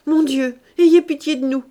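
high, loud plea; wife terrified at the sight of gold; frightened cry. Acted. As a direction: cry out to God in terror. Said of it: "madame Grandet cria".

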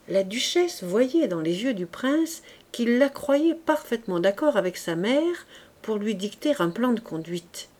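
ordinary delivery

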